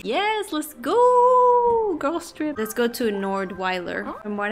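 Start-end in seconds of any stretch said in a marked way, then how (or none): 0.0-2.6 high, loud, long-drawn whoop; excited cheer. Real, not acted. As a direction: cheer it out, stretching the last word. Thrilled to accept trip invitation.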